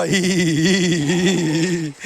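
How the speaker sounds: mock giggle, hee hee hee; teacher demonstrating a playful giggle; mock laugh. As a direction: fake a little giggle for the kid. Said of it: "sir ने बोलने हंसना".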